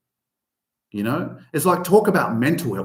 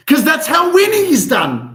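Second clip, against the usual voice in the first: loud, high fired-up motivational catchphrase; Danilo's catchphrase; 11 semitones higher, 8 dB louder than usual. Retold or imitated. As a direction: belt it out like a pumped-up coach rallying the room. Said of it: "Danilo would say".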